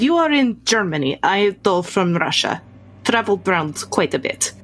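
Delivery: put-on matter-of-fact Russian-accented traveler. Traveler telling Maria she's from Russia, Russian accent. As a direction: explain your origins in a Russian accent. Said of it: "German with a Russian accent".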